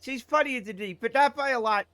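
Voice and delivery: put-on high-pitched Boston accent; Boston [name]. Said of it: "Boston [name]".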